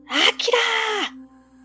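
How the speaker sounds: high, long drawn-out call; grandma calling out. Acted. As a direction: stretch the name out slowly from afar. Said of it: "また祖母の大きな声がした". (ゆっくり長く呼ぶ祖母の大声)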